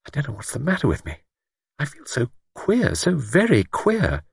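uneasy, worried and faltering; apprehensive. A narrator's reading. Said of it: "a definite note of apprehension".

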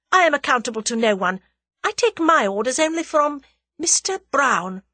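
high, loud, haughty declaration; imperious, indignant lady; flashing anger. A narrator's reading. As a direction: assert her independence with force. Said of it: "her eyes flashing".

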